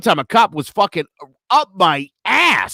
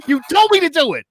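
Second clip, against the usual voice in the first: high, loud indignant retort; indignant protest; 7 semitones higher, 4 dB louder, faster than usual. Retold or imitated. Shot driver to officer who told him, indignant. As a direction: throw the blame back, acted out.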